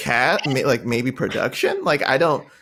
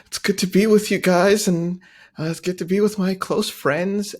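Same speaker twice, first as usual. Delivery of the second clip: mock sweet; 6 semitones higher than usual. Mocking her tearful speech.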